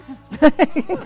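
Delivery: short loud laugh. Real, not acted. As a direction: laugh at yourself. Laughs at own line.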